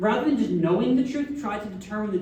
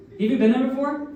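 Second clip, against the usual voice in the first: ordinary delivery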